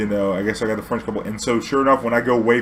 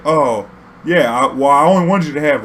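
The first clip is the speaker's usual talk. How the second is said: put-on higher, louder sheepish drunk; drunk guy voice; 4 semitones higher, 7 dB louder than usual. Passenger caught out over the pills, loud sheepish drunk. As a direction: fumble the excuse loudly.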